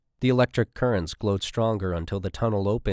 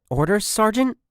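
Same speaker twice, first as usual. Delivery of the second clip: tense; 14 semitones higher, 6 dB louder than usual. A narrator's reading. Tense soldier asks.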